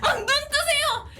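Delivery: high, urgent mock shouting of frantic nurses. Nurses slapping her cheek, retold as high urgent shouting. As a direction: call out to wake someone fainting, half laughing.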